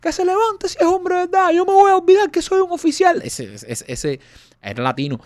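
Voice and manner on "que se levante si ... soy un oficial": high, loud enraged officer; insulted fury. Retold or imitated. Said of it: "el tipo rojo, insultado". (oficial insultado gritando con furia)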